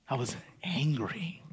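whispered admission; hushed confession. Real, not acted. Pastor whispering his boyhood anger to the church. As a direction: let it slip out in a whisper.

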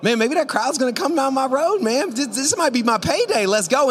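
high, loud, mounting excitement; acted-out eager excitement; excited. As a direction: blurt it out, thrilled at the chance. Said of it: "you kinda get excited".

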